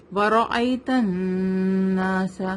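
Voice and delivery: slow وَرَأَيْتَ النَّاسَ with a long nasal n; ghunna. Shown as a demonstration. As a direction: hold the نّ of النَّاسَ in the nose. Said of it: "آواز کو نوز میں تھوڑی دیر روک کر". (النَّاسَ میں ناک میں آواز روک کر)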